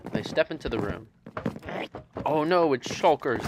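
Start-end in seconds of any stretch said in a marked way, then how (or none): 2.2-3.4 higher, louder cry of alarm; mild screech. A narrator's reading. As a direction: screech it out, drawn out slowly. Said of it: "they screech".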